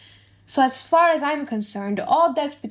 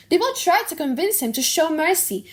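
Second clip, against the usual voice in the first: ordinary talk, nothing marked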